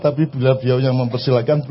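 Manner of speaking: usual speaking voice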